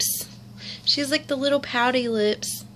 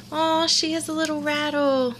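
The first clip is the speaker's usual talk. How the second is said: ordinary talk, nothing marked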